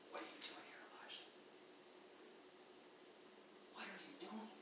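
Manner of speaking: breathy whisper with no voice. Shown as a demonstration. God whispering to Elijah in the cave. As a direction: whisper it, as God draws close. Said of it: "remember, it's a whisper".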